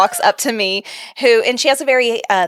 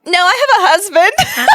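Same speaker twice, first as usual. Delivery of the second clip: laughing; 14 semitones higher, 4 dB louder than usual. Retold or imitated. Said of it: "I said".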